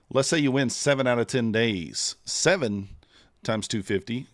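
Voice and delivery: ordinary delivery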